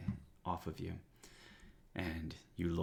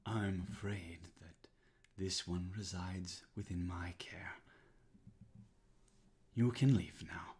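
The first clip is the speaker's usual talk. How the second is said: calm whispered dismissal. Acted. Thorn Mother dismisses the villain.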